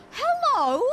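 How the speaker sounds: a sudden high, loud hello. Acted. Greeting the dog in the game with a loud high call. Calling to a character.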